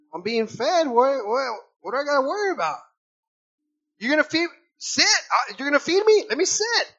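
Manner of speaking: bouncy and glad, like a fed dog; dog's inner voice. Retold or imitated.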